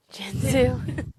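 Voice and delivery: dramatic whisper of the villain's name; hushed, menacing hiss of a name. Acted. To her co-host, whispering the villain's name ominously. Her goal is to ruin him.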